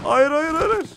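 pleading, voice shooting up in alarm; panicked no. Real, not acted. Enemy rushes him in game. (panikle yükselen hayır)

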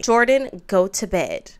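loud order. Real, not acted. Ordering child sim to bed.

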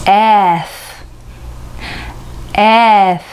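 "F" with a long e, ending in breath; slow "F" with a sustained f; English letter F. Shown as a demonstration. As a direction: stretch "F" and finish on vowelless air. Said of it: "えという母音".

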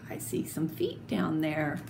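soft unvoiced hint to the kids; whispered aside. Shown as a demonstration. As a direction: whisper the secret clue. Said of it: "whispered aside".

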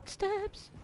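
normal talking voice

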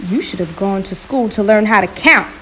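loud, grumpy scolding. A narrator's reading. Brother Dog brushing off the spider, grumpy. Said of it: "Brother Dog said grumpily".

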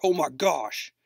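retold startled exclamation, a little lifted; mock shock.

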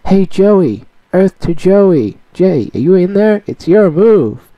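high excited cartoon boy; Yugi voice. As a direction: call out like an eager kid hero. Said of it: "Yu-Gi-Oh! Abridged dubbed by myself".

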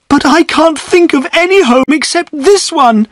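tearful, begging to stay with father; crying out, near sobbing. A narrator's reading. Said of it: "cried Eppie, tears running down her face".

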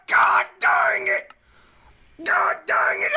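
high, drawn-out screams of protest; outraged yelling. Real, not acted. Player interrupted while building, outraged yelling. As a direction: yell slowly, stretching every word in fury.

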